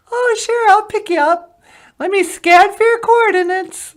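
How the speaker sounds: very high, loud squeaky alien. Acted. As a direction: greet him like a tiny cartoon alien.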